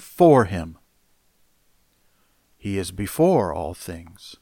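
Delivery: scripture reading. Acted. Reads Colossians aloud in sermon.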